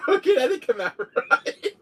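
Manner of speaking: high, laughter-choked speech; laughing. Real, not acted. Cracking up after a failed girl impression, laughing. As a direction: talk through helpless giggles.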